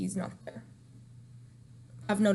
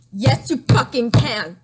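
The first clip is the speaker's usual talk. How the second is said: much louder, slow, furious insistence; angry retort; 10 dB louder, slower than usual. Real, not acted. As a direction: slam it back at him, slow and loud. Furious retort to absent boyfriend.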